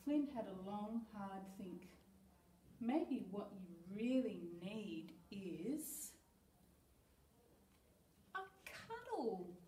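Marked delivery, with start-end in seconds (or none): 2.8-9.6 slow and gentle, lifting on cuddle; tender. Acted. Tender suggestion.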